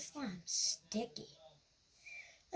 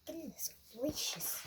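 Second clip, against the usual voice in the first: whispering; 5 dB quieter than usual. Real, not acted. Whispered exasperation.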